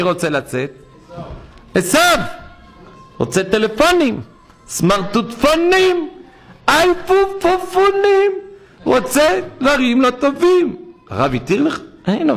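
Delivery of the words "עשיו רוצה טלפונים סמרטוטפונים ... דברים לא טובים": mocking Esau. Preacher mocks Esau craving iPhones, high and sneering. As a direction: ridicule Esau's cravings.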